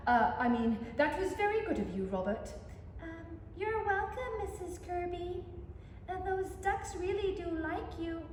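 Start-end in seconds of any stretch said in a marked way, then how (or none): none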